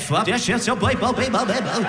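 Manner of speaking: high, bobbing reversed-speech gibberish; backwards record. Acted. Mimicking sleep-talking girlfriend, high backwards-record babble. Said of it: "Sounds like you're turning a record backwards".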